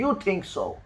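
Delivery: ordinary talk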